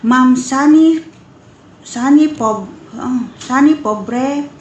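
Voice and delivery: normal talking voice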